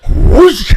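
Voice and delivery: a big loud whoosh of flames going up. Retold or imitated. Telling how the set house burned in seconds, a loud whoosh. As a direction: blow out a loud rushing whoosh, like fire catching all at once. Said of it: "it just went".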